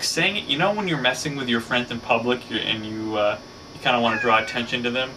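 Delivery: ordinary delivery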